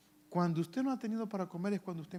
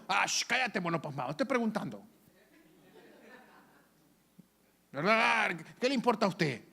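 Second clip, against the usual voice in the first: mock-rude brush-off of a child's question; a hammed-up annoyed parent telling the child to hush; rude dismissive parent; 4 semitones higher, 4 dB louder than usual. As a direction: play the impatient parent shushing the kid. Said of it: "no le responda de esa forma que yo acabo de decir".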